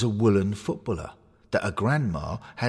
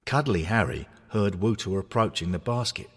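ordinary delivery

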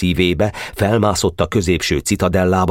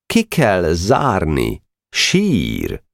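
slow, lordly disdain; haughty; much slower than usual. Acted. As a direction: look down your nose at him. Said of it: "Csele előkelően szólott".

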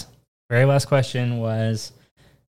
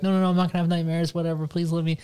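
put-on fast, pleading kid voice, trailing off softer; childish begging; 5 semitones higher, 5 dB quieter, faster than usual. Child begging her parents to stay up, fast pleading. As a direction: beg like a kid who wants to stay up. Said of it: "I would always beg".

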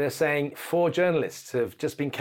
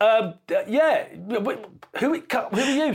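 flustered high stammer; 10 semitones higher, 4 dB louder, slower than usual. Retold or imitated. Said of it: "I went".

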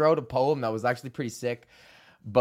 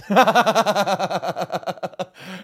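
big loud laugh that breaks out; loud burst of laughter; 4 semitones higher, 6 dB louder than usual. Real, not acted. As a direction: laugh hard at your own joke. Laughing at own joke.

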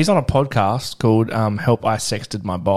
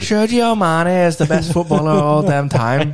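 high hammed-up Scouse lad; overdone Scouse boast; mock Scouse accent; 6 semitones higher, 3 dB louder than usual. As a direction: brag like a Liverpool lad. Imitating scouse footballer.

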